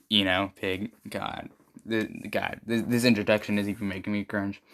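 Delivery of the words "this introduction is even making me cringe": ordinary delivery, nothing marked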